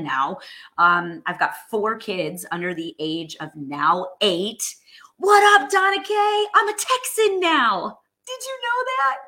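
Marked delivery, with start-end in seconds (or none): 5.2-9.2 much higher, louder squeal of hello; bubbly high-pitched hello to a buddy; excited greeting. Real, not acted. Greeting a friend in chat.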